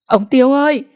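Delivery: high, loud desperate call; shouting for help. A narrator's reading. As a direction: yell across the yard for Tiêu. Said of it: "Bà gào lên để gọi". (gào to gọi "Ông Tiêu ơi")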